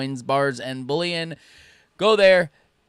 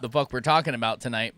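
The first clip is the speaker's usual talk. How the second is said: ordinary talk, nothing marked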